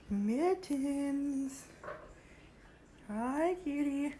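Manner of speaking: high, slow hi kitty; cat-calling voice. Real, not acted. Owner calling her cat sweetly.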